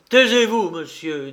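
high, loud angry order; barely contained anger. A narrator's reading. Old farmer silencing the count, barely contained anger. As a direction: silence him with a burst of anger. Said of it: "une voix qui tremblait d'une colère mal contenue".

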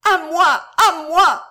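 loud, high-pitched shouts; forceful cries for help. A narrator's reading. Girl hopes the guard will save her, forceful cries. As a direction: shout harder, hoping for rescue. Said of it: "continuait de crier Claude avec plus de force".

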